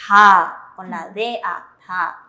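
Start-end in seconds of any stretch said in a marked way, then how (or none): 0.1-0.7 "ta," said clearly and loudly as the Korean syllable; "ta," pronunciado con claridad; syllable demo. Shown as a demonstration. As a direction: spell out "ta," for the class. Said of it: "la tercera leemos".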